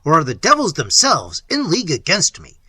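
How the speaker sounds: loud, frantic cry to the ghost; Manfred shouting at his grandfather's ghost. Acted. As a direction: demand an answer from the spectre. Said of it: "cried Manfred".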